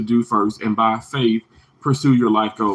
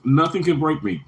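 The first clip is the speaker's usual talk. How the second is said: usual speaking voice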